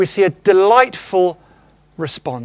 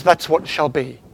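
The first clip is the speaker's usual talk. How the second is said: a hint of a lower, grand genie; genie granting a wish; 6 semitones lower, 3 dB quieter than usual. Preacher voicing the genie to the wisher, lightly lower. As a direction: lightly drop into a lordly genie. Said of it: "And the genie says".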